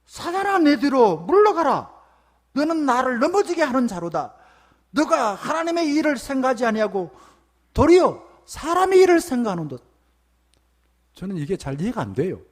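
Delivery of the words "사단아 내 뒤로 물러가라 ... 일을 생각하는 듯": fierce rebuke. Acted. Jesus rebuking Peter, a fierce high shout. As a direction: thunder it at him: get behind me. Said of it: "아주 무시무시한 말을 해요".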